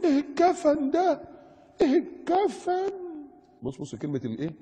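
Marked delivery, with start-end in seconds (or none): 0.0-2.9 high, slow, drawn-out wail; mock horrified woman. Preacher mimics the writer questioning veiled girls, mock horrified. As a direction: stretch it out high in mock horror.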